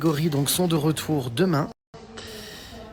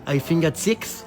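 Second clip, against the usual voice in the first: hesitant English with a French accent; real French accent, halting English; slower than usual. Real, not acted. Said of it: "écoutez mon accent anglais".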